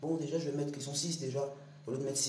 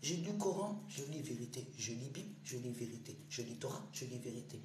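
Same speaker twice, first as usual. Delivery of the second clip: solemn litany; 5 semitones lower, 7 dB quieter than usual. Acted. Chanted litany of scriptures.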